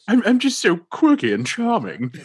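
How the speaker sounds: high, loud, bumbling posh Englishman; Hugh Grant impression. Mocking Hugh Grant's character to a friend, high and loud. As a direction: ham up a charming English stammer. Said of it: "my impression of Hugh Grant".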